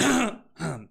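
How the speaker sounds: clearing throat, then a strained word; hacking at a stuck throat. Real, not acted. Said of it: "I've got something stuck in my throat".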